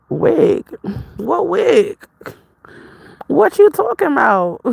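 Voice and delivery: high, incredulous "what wig"; baffled. Retold or imitated. To the stranger talking about a wig, high baffled surprise. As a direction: pitch it way up in confused disbelief. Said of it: "I'm like".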